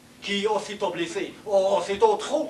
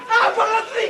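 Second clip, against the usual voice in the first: laughing; 10 semitones higher, 4 dB louder, much faster than usual. Acted. Laughing while speaking.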